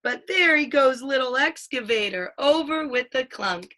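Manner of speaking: lively picture-book read-aloud, playing up the sound words; reading to a child. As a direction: read it like a bedtime book for a toddler.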